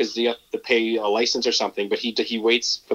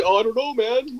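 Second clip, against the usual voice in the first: playing dumb; 11 semitones higher than usual. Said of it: "just totally".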